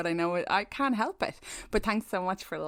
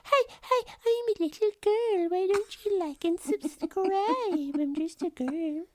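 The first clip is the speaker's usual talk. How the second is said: imitating creepy little girl; 8 semitones higher than usual. [name] copying the eerie voice to Will, mock little girl. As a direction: copy the creepy child voice. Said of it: "that was like".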